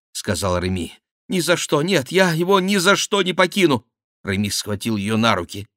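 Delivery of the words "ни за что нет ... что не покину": high woman's desperate refusal; отчаянный отказ. Acted. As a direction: refuse in a raised, pleading pitch. Диана отказывается уйти. (высоким женским голосом, отчаянно)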